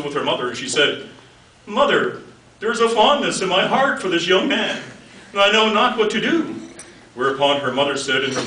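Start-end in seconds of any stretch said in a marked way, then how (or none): 1.7-6.6 higher-pitched voice of a mock-earnest daughter; daughter confiding. Daughter confiding in mother about a young man, higher mock-earnest voice. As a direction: play a daughter's plea in mock scripture.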